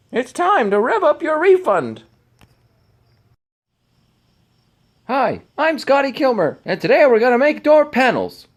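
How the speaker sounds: very high-pitched, loud YouTube host parody; exaggerated high, emphatic host impression; Scotty Kilmer. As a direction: belt it out high like an over-the-top car-show presenter. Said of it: "That's my best Scotty Kilmer impression".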